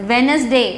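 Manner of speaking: Wednesday as "WENZ-day", no D before the N; silent D. Shown as a demonstration. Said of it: "எப்படி pronunciation பண்ணணும்னு சொல்றேன்".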